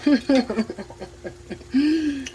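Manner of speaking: a happy laugh after a bite; delighted laugh. Real, not acted. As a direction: laugh with pleasure at the taste. Tasting the food, delighted.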